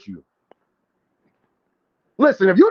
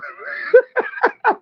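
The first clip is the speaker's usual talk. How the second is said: shrieking burst of laughter, much higher. Real, not acted. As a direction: let out a big high cackle. Laughs at his own joke.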